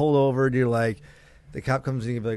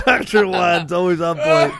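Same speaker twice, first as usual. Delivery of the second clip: laughing hard, slow; 5 semitones higher, slower than usual. Real, not acted. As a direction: laugh through the words. Laughing at the joke.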